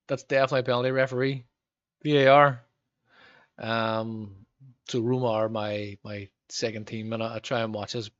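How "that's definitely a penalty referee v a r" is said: louder and louder, ending in a loud call for VAR; appeal for penalty. Real, not acted. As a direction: protest to the referee like a fan on the sofa.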